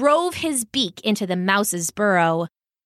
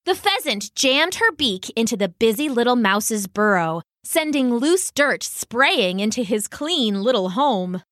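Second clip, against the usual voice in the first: ordinary delivery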